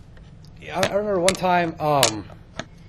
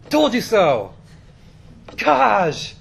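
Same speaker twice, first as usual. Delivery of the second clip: loud, higher told-you-so outburst; exasperated gloating; 7 semitones higher, 9 dB louder, much slower than usual. Retold or imitated. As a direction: throw it out as a loud, exasperated scolding. Reenacts scolding wife over stolen purse.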